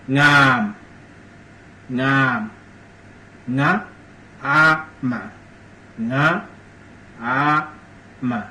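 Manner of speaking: ngam broken into nga, a, ma, slow and separated; syllable-by-syllable demo. Shown as a demonstration. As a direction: sound out ngam one syllable at a time. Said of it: "Ngam mean yawn".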